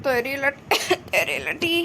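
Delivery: low, soft words broken by coughs; coughing while talking. Acted. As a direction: answer through a coughing fit. Answers friend amid a coughing fit. (இருமலுக்கு நடுவே தாழ்ந்த குரலில்)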